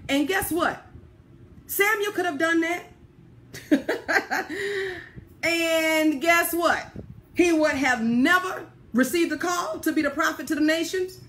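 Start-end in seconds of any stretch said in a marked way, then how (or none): 3.5-5.2 a real warm chuckle rising high; hearty laugh. Real, not acted. Preacher laughing at the congregation, amused laugh. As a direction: laugh out at the thought.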